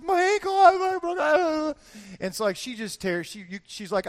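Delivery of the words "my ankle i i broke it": crying wail. Retold or imitated. Wife on the ground with a hurt ankle, wailing. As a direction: imitate a hammed-up cry of pain.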